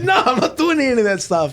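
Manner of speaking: laughing denial. Retold or imitated. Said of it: "He's like".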